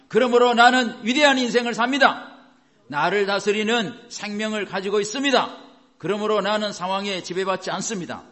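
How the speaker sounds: high, emphatic chant-like declaration; loud proclamation. Shown as a demonstration. Preacher leading congregation to declare together, loud proclamation. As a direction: proclaim each line firmly and loudly. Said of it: "이렇게 여러분 선포하라고요".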